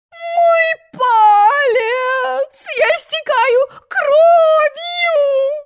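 very high, very slow crying wail; hammed-up wailing over a finger; wailing like a siren. A narrator's reading. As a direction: howl it out like a steam whistle. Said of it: "Она уже ревела, как паровозный гудок".

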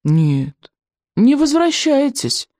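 high, slow glum official; dreary refusal; gloomy judge. A narrator's reading. Judge voiced.

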